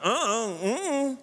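high, whining mock protest; husband whining inside at his wife's vision; whiny refusal. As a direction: play the reluctant husband, whimpering no. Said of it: "baby, I'm thinking".

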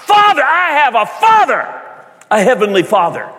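high, loud exultant cry. Acted. Preacher showing how the adopted cry out to God, crying out. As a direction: cry out to the Father at full voice. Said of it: "that you cry out".

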